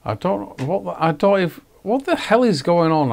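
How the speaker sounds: high-pitched, faltering bewilderment; baffled exasperation. Real, not acted. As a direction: stammer into a high, confused question. Lost in the game's menus.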